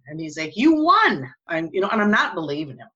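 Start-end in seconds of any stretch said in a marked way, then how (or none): 0.6-1.3 much higher, louder, firm fall; excited insisting. Retold or imitated. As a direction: insist she really won. Husband insisting on the win.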